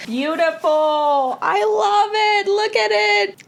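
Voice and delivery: high, drawn-out adoring coo; slow high squeal of admiration; gushing delight. Real, not acted. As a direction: coo over it, stretching the words. Admiring fan art.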